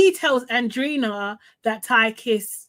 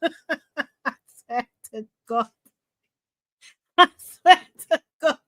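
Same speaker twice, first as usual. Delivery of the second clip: laughing through the words, pitched up; giggling disbelief; 4 semitones higher than usual. Real, not acted. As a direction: crack up while swearing it. Laughing at Ty's antics.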